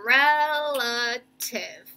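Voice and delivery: "relative" very drawn out, each syllable held. Shown as a demonstration. Teacher introducing a tricky word, "relative" slowly sounded out. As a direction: stretch "relative" into three long syllables. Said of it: "how we're breaking it".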